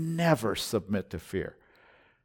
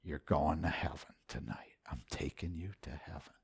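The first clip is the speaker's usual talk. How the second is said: hushed whisper, barely voiced; voice of God whispered; 7 semitones lower, 15 dB quieter than usual. The Spirit of God whispering to the preacher at night. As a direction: breathe it like a holy secret. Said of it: "and the Spirit of God said".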